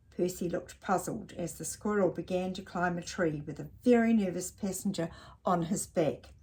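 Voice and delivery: plain reading voice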